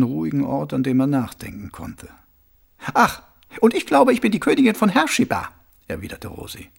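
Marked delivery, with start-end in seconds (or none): 2.9-5.5 high, mocking disbelief; sarcastic retort. A narrator's reading. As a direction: scoff in a high, sarcastic woman's voice. Rosi mocks Mumm's memory claim.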